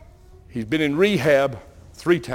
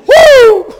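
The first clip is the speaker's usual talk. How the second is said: high loud woo; loud, much higher whoop of remembered pain; whooping holler; 16 dB louder than usual. Real, not acted. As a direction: let out a big high holler. Reliving the whipping.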